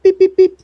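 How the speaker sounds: high, loud imitated electronic beeping; mock beeps. Imitating the airlock beeping, high and loud. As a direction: chirp like an airlock machine.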